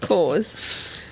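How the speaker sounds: Coors with no R, like "cawz"; Australian accent. Shown as a demonstration. Shows her own R-less way.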